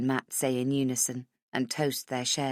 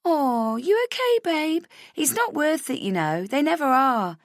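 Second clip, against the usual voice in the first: put-on chorus of girls; 11 semitones higher, 4 dB louder than usual. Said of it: "with a chorus of".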